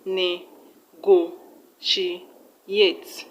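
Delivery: "Ne-go-she-ate" very slowly, each part separate; four spaced beats in "Ne-go-she-ate"; syllable by syllable. Shown as a demonstration. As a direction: spell out "Ne-go-she-ate" beat by beat. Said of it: "So the third syllable is on".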